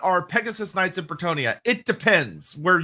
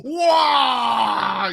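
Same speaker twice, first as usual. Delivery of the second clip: mock wail; 5 semitones higher than usual. Mock wail after the joke.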